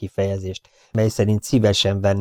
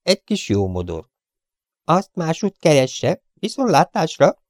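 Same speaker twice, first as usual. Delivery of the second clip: high, curt, insolent gangster voice; rude Chiefen; 9 semitones higher, 3 dB louder than usual. Acted. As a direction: sneer the intruder off. (magas, pimasz, goromba hang)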